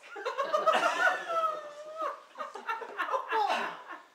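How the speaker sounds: long, high bubbling laugh. Real, not acted. Poet laughing at her own poem's punchline, high and long.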